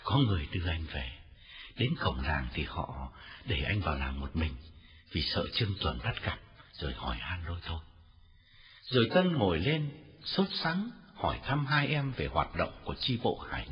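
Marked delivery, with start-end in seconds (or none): 0.0-7.8 lower, softer, worn-out voice; tired smile. A narrator's reading. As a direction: speak weakly through a tired smile. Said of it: "nở nụ cười mệt mỏi nói".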